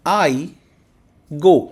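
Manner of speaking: slow dictation. Shown as a demonstration. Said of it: "इसको हम कैसे लिखेंगे".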